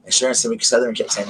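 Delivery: ordinary delivery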